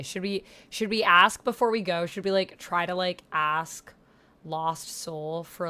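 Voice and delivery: usual speaking voice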